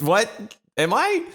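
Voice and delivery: high, squeaky mock disbelief; incredulous high-pitched questions; acted surprise. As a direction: replay being caught totally off guard, pitch shooting up. Acting out his surprise at being discharged.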